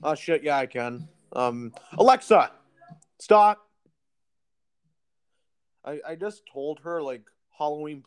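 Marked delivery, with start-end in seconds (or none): 2.0-3.7 calling out sharply to Alexa; loud command. Real, not acted. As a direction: raise the voice to stop the speaker. Commanding the smart speaker.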